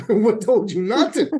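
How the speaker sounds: loud, high comeback on the edge of laughter; mock retort. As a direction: throw it back loudly, punching NOT. Imagined retort, laughing around it.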